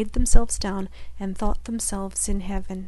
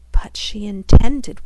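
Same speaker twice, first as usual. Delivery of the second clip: plain reading voice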